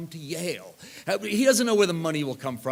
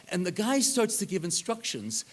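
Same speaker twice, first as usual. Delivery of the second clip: normal talking voice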